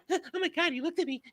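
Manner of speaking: put-on high breathy girly gush; gushing like a smitten girl; mock swooning girl. Mocking the female robot.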